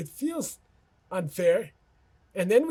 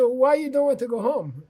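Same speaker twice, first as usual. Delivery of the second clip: puzzled question; 7 semitones higher, 6 dB louder than usual. Retold or imitated. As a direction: pitch jumps high and louder in disbelief. Boss asks puzzled employees.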